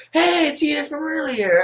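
high, loud squeaky shout; excited recognition. Pretend. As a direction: squeal a greeting at a familiar rival. Spots player from earlier.

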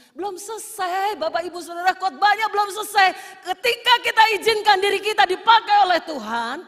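loud, high sermon build; fiery preaching. Acted. Preacher rousing the congregation, full-force preaching.

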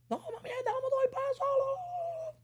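very high, slow squeaky mock of his brother. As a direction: squeak it out, slowly, like a joke. Imitando al hermano. (imitación muy aguda y lenta del hermano)

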